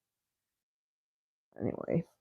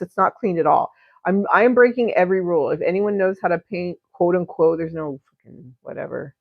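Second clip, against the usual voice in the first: ordinary delivery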